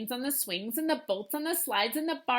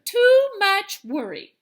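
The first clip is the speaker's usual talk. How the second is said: louder, slow, scolding grandma; grandmother; 8 semitones higher, 10 dB louder, slower than usual. Acted. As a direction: wag a finger at the worrier. Grandmother scolding, read aloud.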